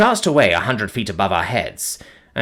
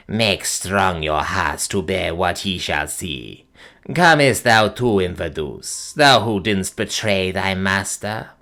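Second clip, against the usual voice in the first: low, slow, eerie crone voice; piping old witch; 3 semitones lower, slower than usual. Acted. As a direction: croak it out like an ancient witch. Gagool warns the party.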